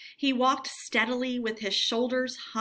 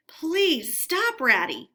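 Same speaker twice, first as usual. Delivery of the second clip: high, louder pleading cry; anguished plea; 5 semitones higher, 5 dB louder, slower than usual. Acted. Mole begs Rat to stop, anguished plea. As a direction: beg him to stop, heart aching. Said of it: "pleaded the poor mole in anguish".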